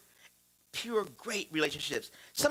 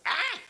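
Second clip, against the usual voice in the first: daughter's yell; 11 semitones higher than usual. Retold or imitated. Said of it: "she's like".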